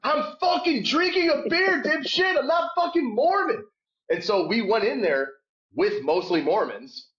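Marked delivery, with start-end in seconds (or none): 0.0-3.6 dad yelling back at the hecklers, mock outraged shout. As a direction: snap back at the hecklers, beer raised. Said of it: "I'm like".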